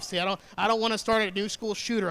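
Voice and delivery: ordinary talk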